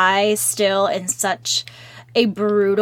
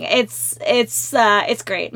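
usual speaking voice

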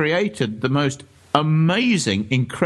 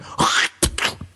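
sharp, voiceless hissing burst; imitated dispenser noise. Ghost hunter retelling the noise heard in the toilets, imitated noise. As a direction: mimic a sudden spurt of spray. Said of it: "we heard this noise, and it went".

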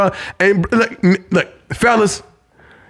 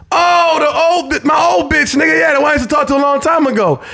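sudden recognition; 8 semitones higher than usual. Retold or imitated. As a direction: shout it as it finally clicks. Suddenly remembering the ex.

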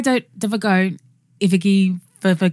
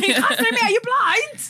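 high squeaky imitation of the uncle; a high, put-on voice scolding his son; high mock uncle voice; 12 semitones higher than usual. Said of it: "he was like".

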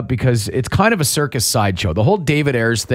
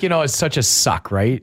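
ordinary talk, nothing marked

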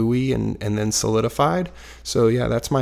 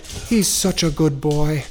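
put-on slow, higher little old Irish lady; badly faked Irish old lady; attempted Irish accent; 4 semitones higher, slower than usual. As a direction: coo it slowly in a shaky attempt at Irish. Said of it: "I can't do an Irish accent".

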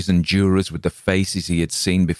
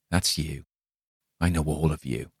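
quiet accusation; 5 dB quieter than usual. Acted. Dying man's quiet accusation.

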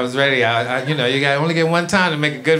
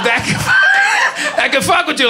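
ordinary talk, nothing marked

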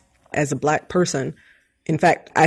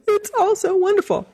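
gushing. Retold or imitated. Mock gushing at card.

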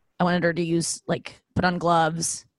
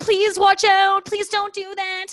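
much higher, slow whiny plea; retelling her usual plea to a hairdresser, high and slow; mock pleading; 13 semitones higher, 4 dB louder, slower than usual. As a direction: beg like a nervous client.

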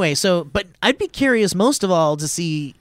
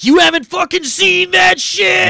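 loud, high, slow mock outrage; hammed-up yell at someone who hasn't seen a film; snobby movie-guy shout; 11 semitones higher, 6 dB louder than usual. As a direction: shout him down like a film snob. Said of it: "that guy that's like".

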